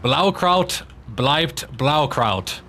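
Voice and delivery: "Blaukraut bleibt Blaukraut" spelled out very slowly, syllable by syllable; slow careful tongue twister. Shown as a demonstration. Gamer slowly reading a German tongue twister to his friends. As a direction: sound out each German word slowly. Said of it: "German tongue twister".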